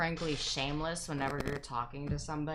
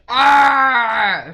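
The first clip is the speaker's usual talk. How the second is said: frustrated yell; 5 semitones higher, 15 dB louder than usual. Retold or imitated. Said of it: "and Matt's just like".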